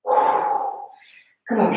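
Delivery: ordinary talk, nothing marked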